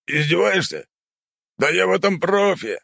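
high, proud boast; boastful uncle voice. Acted. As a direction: brag as the old uncle.

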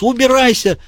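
high, frantic villain's voice; a cornered madman ordering him out; unhinged Diogenes. A narrator's reading.

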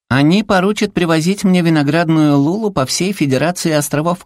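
high, cutesy gushing, climbing at the end; enraptured little creature. A narrator's reading. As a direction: gush like a greedy little critter. Зверёк восторженно мечтает о газировке. (восторженный высокий голосок зверька)

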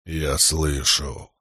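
low, slow godlike voice; rumbling Stormfather. A narrator's reading. Storm god answers a man, rumbling low and slow. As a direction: draw the words out low, like far thunder. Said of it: "Голос загрохотал точно далёкий гром".

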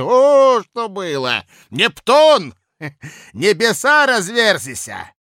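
put-on loud, high drunken bluster; drunken blustering official. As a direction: boast it loud like a tipsy clerk. Drunk Kozulin's long boast.

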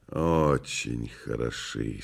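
low, slow and soft; cool dry remark. Acted. Woland appraising the sooty pair, low slow dry voice. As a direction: say it slowly, low, under your voice.

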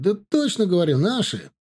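high, insistent calling voice. A narrator's reading. Lookout confirming friendly riders to those below, insistent call. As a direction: insist from up in the tree.

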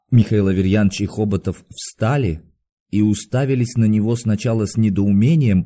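ordinary delivery

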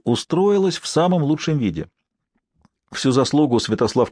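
usual speaking voice

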